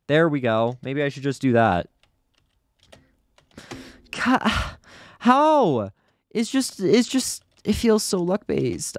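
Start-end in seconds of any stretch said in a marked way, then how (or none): 4.1-9.0 high-pitched, real frustrated protest; exasperated gamer at a much higher pitch; exasperated whine. Real, not acted. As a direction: complain high, stopping and restarting. Frustrated at repeatedly failing the stage.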